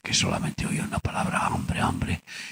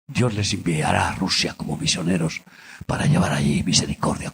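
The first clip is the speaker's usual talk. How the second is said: fervent retold prophecy; 5 semitones higher, 3 dB louder than usual. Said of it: "Y les dije".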